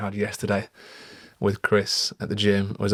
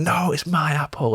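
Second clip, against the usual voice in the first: retold scream; 7 semitones higher than usual. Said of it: "she screamed in my face and went".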